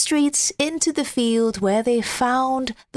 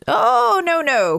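high, loud pleading protest; crying out; 7 semitones higher, 4 dB louder, slower than usual. Acted. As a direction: plead in alarm as the snowman. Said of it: "cried the snowman".

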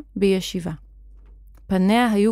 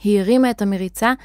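plain reading voice

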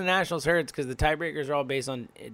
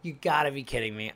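usual speaking voice

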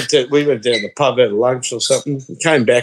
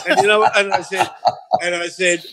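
normal talking voice

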